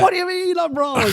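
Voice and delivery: high, indignant protest; mock player outrage. Player protesting to the ref, mock outraged high pitch. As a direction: squeal it like a player denying the foul.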